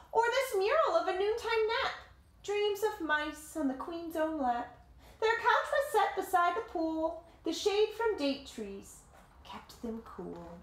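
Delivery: story narration. A narrator's reading. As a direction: tell it like a picture book to kids. Reading the picture book aloud.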